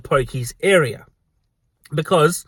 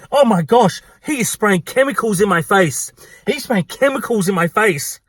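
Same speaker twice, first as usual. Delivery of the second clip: high-pitched hammed-up outrage; going tutti frutti; 5 semitones higher than usual. Customer complaining about the table spray, going tutti frutti. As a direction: imitate a customer melting down in indignation. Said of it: "go tutti frutti again".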